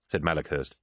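ordinary delivery

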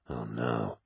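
a low hushed whisper of dread. Acted. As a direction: breathe it out, barely voiced. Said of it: "whispered Loken".

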